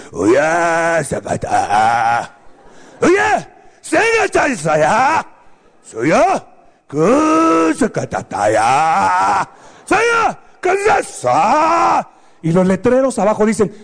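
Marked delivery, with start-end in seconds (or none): 0.1-12.1 hammed-up samurai-movie Japanese bark; mock Japanese. Ninja husband barks at his wife in mock Japanese. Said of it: "el ninja le habla así".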